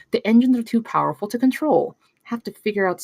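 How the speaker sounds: light up over the engines; excited tech talk. Acted. Young inventor tells Inez about the drone, excited.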